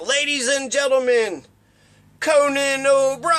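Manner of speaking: high, slow, stretched announcer introduction; mock big-show intro, building to a held name; talk-show announcer. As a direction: draw out the star's name like a late-night announcer. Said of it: "when they introduce him, they're like".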